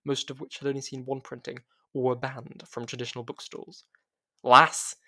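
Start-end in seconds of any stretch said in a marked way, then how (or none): 4.4-5.0 a huge Scottish shout across the shop, much louder; Scottish bellow. A narrator's reading. Mandy greets Ramon from behind the counter, booming Scottish bellow. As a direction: bellow it like a brassy Scottish shopkeeper. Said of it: "Mandy bellowed in her thick Scottish accent".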